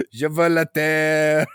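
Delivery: slow villainous Quebec French; quebec french accent. Pretend. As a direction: announce conquest like a hammy invader. Quebecois invader voice.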